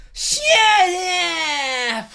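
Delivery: long, drawn-out screech stepped back from the mic; hugely stretched-out yell; screamed imitation. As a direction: imitate a wrestler screaming into the microphone. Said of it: "I'm gonna make a noise now".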